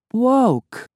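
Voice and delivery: put-on high small girlish voice; innocent girl voice. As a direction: say it like a sweet little girl. Unicorn voice.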